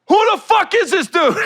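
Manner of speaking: loud, high imitation of an angry outburst; going ham. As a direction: blow up at a stranger, mimicked big. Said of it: "went ham on the dude".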